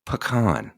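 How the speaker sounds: "Pecan" as puh-KAHN, stress on the second syllable; pecan said right. Shown as a demonstration. Teaching viewers the Southern way to say it, puh-KAHN. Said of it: "Say it with me".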